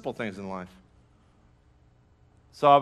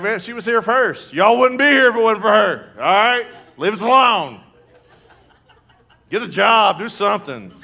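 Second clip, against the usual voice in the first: high, loud comic rant; mock fatherly scolding; 7 semitones higher, 8 dB louder than usual. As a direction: scold the kids in playful outrage. Acts dad scolding his children.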